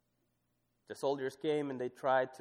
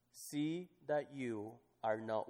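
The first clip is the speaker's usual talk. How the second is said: slow, softer, held phrase; drawn out; 4 dB quieter, slower than usual. Shown as a demonstration. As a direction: stretch it out and leave it hanging. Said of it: "But I want you to look at this".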